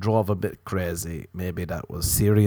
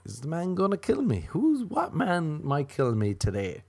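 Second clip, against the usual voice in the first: mock anxious wondering; 7 semitones higher, 4 dB quieter than usual. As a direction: play a woman wondering who the danger is. Acts women's daily guessing game.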